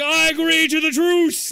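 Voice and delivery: high, loud mock-pompous declaration; Greg FPS. Teammate replaying Greg's agreement, mock-grand shout. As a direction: announce it to the whole hall. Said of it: "you just hear Greg".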